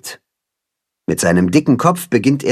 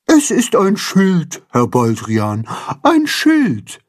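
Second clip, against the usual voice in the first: high, drawn-out carp lady voice; Frau Feudel, correcting; 7 semitones higher, slower than usual. A narrator's reading. Carp Frau Feudel corrects Herr Baldrian, insistent. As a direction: spell it out slowly, rising on the repeat. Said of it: "erklärt Frau Feudel".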